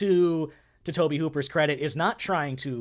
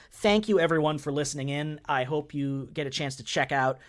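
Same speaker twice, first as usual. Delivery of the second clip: ordinary talk, nothing marked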